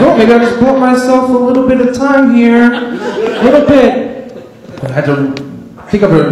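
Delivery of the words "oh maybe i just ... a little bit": giggly stalling. Retold or imitated. Stoned kid thinking aloud.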